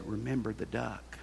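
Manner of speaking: hushed, knowing warning; sly quiet threat. Acted. Sister hushing a secret threat to her little brother, quiet and sly. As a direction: lean in and threaten him softly.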